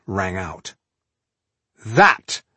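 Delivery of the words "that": loud, ominous emphasis; dark warning. A narrator's reading. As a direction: open a grim warning forcefully. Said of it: "Tungdil told Balindar darkly".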